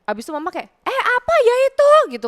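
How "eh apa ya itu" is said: high loud call from outside; loud calling mother. Retold or imitated. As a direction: call out to ask what the noise is.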